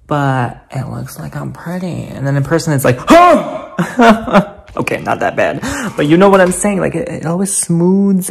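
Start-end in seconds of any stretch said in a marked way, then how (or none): 3.0-4.6 slow, loud drawn-out gasp of horror; mock shocked gasp. Seeing her real face in person, a loud mock gasp.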